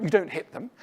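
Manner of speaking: normal talking voice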